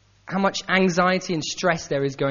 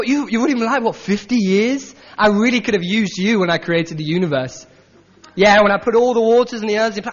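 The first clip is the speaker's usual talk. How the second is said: mock-grateful, louder sarcasm; sarcastic God; 3 dB louder than usual. Preacher voicing God mocking Job, sarcastic and loud. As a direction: needle Job with exaggerated thanks. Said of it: "a hint of sarcasm from God".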